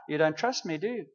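a calm, knowing question; quiet God voice. Retold or imitated.

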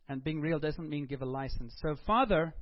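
ordinary talk, nothing marked

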